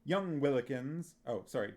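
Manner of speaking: ordinary delivery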